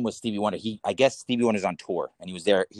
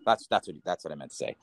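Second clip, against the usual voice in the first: normal talking voice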